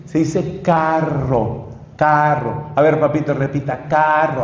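carro con erre bien vibrada, lento y suave; enseñarle carro despacito, marcando la erre; corrigiendo al niño. Shown as a demonstration. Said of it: "hasta que por fin lo pronuncia bien". (papá que enseña con paciencia a decir carro)

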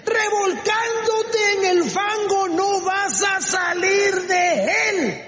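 high, drawn-out impassioned exhortation; preaching at full force. Real, not acted. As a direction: rouse the congregation with long, ringing phrases. (predicación exaltada a gritos)

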